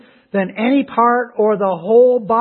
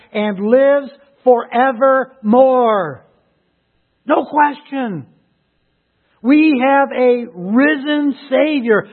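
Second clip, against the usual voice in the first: forceful proclamation with drawn-out key phrases; triumphant preaching; 4 semitones higher, 5 dB louder, slower than usual. Real, not acted. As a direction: build each phrase to a ringing declaration. Preaches scripture at full force.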